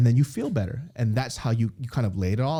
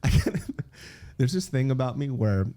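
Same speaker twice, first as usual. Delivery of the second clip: usual speaking voice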